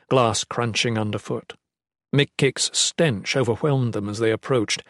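plain reading voice

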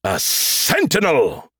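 slow, drawn-out hissed reveal; gleeful announcement. A narrator's reading. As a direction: relish the big reveal. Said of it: "announced with glee".

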